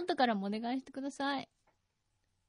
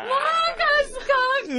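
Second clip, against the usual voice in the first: much higher, louder cheer; excited cheer; 13 semitones higher, 8 dB louder, much faster than usual. Real, not acted. Cohost cheers Marcus's piercing, excited shout. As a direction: hype him up loudly.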